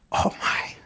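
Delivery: breathy gasped whisper of shock. Retold or imitated. Onlooker gasping at the cashier's rudeness, stunned whisper. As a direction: whisper it, stunned by what you saw.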